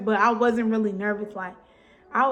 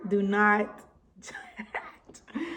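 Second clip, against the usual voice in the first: very slow, spaced out and softer; slow emphatic; 5 dB quieter, much slower than usual. Shown as a demonstration. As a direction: draw out every word with a laugh. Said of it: "Don't judge".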